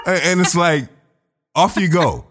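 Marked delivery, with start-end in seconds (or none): 1.5-2.2 high-pitched quoted dismissal, falling; high, falling mock dismissal; mock brush-off. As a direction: toss it off as a mock polite send-off. Mimics a British brush-off phrase.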